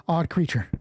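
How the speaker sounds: usual speaking voice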